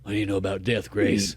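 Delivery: low, soft mock-jaded mom voice; imitated jaded mom. Mom challenges Grace about death, imitated jaded voice. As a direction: play the smoking mom, low and hushed. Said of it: "smoking a cigarette".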